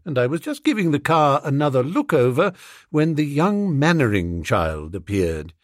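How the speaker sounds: usual speaking voice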